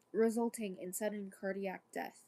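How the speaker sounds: ordinary delivery, nothing marked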